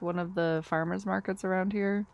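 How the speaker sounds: usual speaking voice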